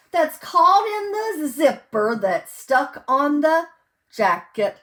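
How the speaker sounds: loud, sing-song picture-book reading; reading to children. Acted. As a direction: read each page big and bright for little listeners. Reads picture book aloud.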